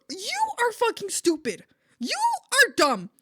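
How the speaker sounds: high, loud furious rant, hammed-up; so mad. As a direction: re-enact blowing up at the roommate. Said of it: "I was so mad".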